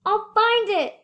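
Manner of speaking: high, loud determined cry; cried out. A narrator's reading. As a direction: call it out with resolve. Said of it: "cried Horton".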